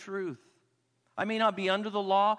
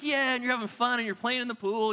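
higher-pitched, playful whoop into chatter; carefree excitement; 5 semitones higher, 3 dB louder than usual. Acted. Swimmer unaware among friends in the pool, carefree excitement. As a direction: act out oblivious poolside fun.

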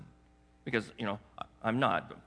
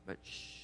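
shushing; 19 dB quieter than usual. Acted. Jesus hushes again.

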